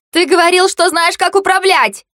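high-pitched, furious yell; angry yelling. A narrator's reading. Marsha accusing the sled driver, furious high yelling. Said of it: "гневно орала Марша".

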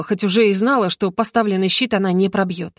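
plain reading voice

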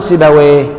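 "سيبويه" with the final vowel as a clean e; imala model word. Shown as a demonstration. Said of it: "كما نقول".

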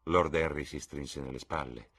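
ordinary delivery

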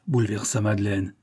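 ordinary delivery

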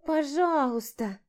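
high, slow pouty plea; pouting. Acted. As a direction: whine it with a pout. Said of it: "Надула губы Влада".

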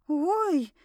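high little yelp of discomfort; high-pitched pained squeak. A narrator's reading. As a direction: squeak out a startled oh. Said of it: "пискнула".

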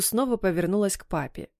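ordinary delivery

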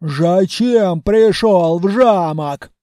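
slow, heavy, loud orc voice; menacing orc grilling a prisoner; gruff orc interrogator. A narrator's reading. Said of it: "допрашивал пленного в своей неповторимой манере".

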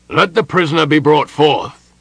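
loud, high military command; shouted formal summons; ringing order. A narrator's reading. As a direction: call it out across a parade field. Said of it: "Connell ordered".

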